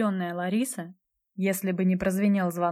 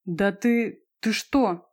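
halting, indignant stammer; choking with indignation; 3 dB louder, much slower than usual. A narrator's reading. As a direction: stumble over the words in outrage. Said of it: "захлебнулась возмущением Брайлович".

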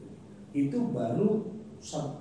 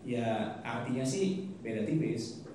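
usual speaking voice

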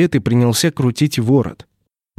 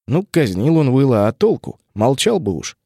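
usual speaking voice

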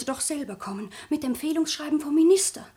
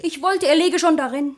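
much louder, spiteful blurt; loud outburst; 10 dB louder than usual. Acted. Sabine blurting to her grandmother she wants the suitor dead, loud. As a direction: spit the wish out.

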